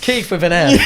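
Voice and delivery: real laughter bursting through the joke; laughing shout. Real, not acted. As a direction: crack up as you blurt it out.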